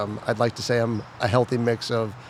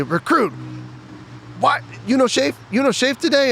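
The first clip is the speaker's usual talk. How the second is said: drill instructor yell; 13 semitones higher than usual. Retold or imitated. Drill instructor screaming at a recruit, high mock yell. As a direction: bark it like a tiny drill instructor. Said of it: "they screamed at me".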